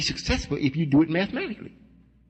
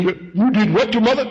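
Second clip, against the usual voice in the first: loud, higher mock scolding; stern father; 6 semitones higher, 5 dB louder than usual. Father confronting child about mother, acted loud outrage. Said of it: "He gets to shaking and trembling".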